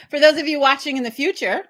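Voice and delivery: high giggly retake; laughing. Shown as a demonstration. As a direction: laugh while repeating it.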